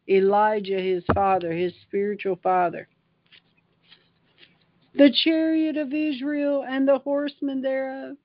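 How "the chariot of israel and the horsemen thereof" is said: crying out in awe. Acted. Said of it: "He cried".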